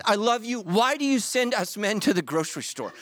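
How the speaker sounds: ordinary delivery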